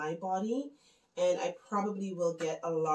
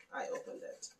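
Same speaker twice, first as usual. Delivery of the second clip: drops to a whisper while repacking the gift; whispered aside; 11 dB quieter than usual. Real, not acted. Repacking the gift for viewers, whispered aside. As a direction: breathe it out under her breath.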